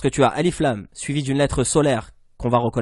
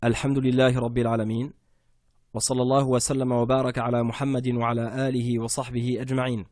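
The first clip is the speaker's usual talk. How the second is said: quick recited basmala and blessings; opening invocation; much faster than usual. Real, not acted. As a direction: recite the opening formula briskly. Opening invocation before lesson. (récitation rapide de l'invocation d'ouverture)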